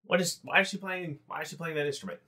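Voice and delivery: a guard confused by the music; puzzled guard. Acted.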